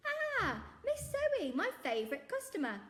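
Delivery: high, loud beaming shopkeeper voice; jolly shopkeeper. Acted. Shopkeeper greeting his favourite customer, beaming. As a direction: greet her like a big jolly man.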